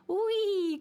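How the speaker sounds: loud high squeal of delight; gleeful cry. Acted. Dreidel squeals as it spins past the squirrel. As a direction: shout it while spinning fast. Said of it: "cried the dreidel".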